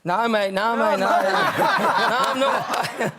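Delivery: repeated denial in a mock English accent. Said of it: "I put on an English accent".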